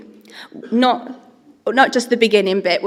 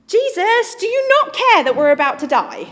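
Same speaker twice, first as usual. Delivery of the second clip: high, loud alarmed plea; panicked disciples; 11 semitones higher, 7 dB louder than usual. Acted. Frightened disciples waking Jesus in the storm, panicked. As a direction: cry out in mock panic, pitched way up.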